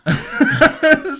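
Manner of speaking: loud high laugh bursting out; burst of laughter. Real, not acted. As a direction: break into a big real laugh. Laughing at their own saga.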